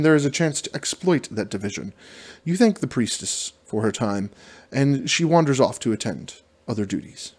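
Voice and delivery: ordinary talk, nothing marked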